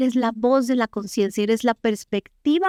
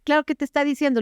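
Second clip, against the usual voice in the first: usual speaking voice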